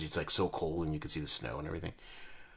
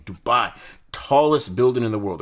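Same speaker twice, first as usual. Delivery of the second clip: hyped-up voice; 4 semitones higher, 9 dB louder than usual. Real, not acted. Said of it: "Why am I talking like this?".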